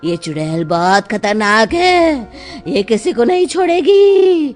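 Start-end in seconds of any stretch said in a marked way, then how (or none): 2.7-4.5 dramatic warning. Acted. Tantrik warns of witch.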